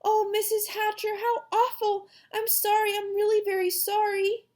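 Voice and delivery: put-on high, upset little-girl apology; girl crying out. A narrator's reading. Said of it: "Sheila cried".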